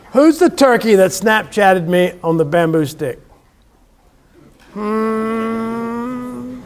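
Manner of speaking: ordinary delivery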